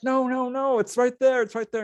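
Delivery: high eager insistence; high excited quote of his own eager self. Retold or imitated. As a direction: point it out eagerly. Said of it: "I'm like".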